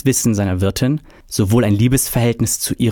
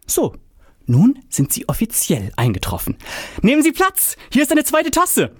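playful host; 13 semitones higher than usual. A narrator's reading. Fabian playing host to inventor.

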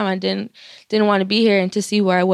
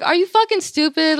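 incredulous retort; 8 semitones higher than usual. Retold or imitated. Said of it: "I'm like".

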